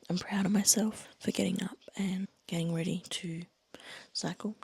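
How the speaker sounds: soft early morning whisper; quiet at night. Real, not acted. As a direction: whisper so the family sleeps. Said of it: "trying to be really quiet".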